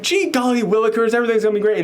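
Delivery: high, gushing sugary fan voice; gushing sweet Annie. Retold or imitated. Reviewer imitating Annie fawning over her favourite author, high and gushing. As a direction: play her as the sweetest, most innocent fan. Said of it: "how good she is at being like the".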